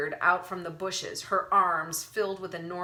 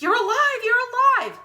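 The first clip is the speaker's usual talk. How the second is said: much higher, louder relieved yelp; yelp of relief; 11 dB louder than usual. A narrator's reading. Wendell seeing Harvey return, relieved yelp. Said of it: "yelped with relief".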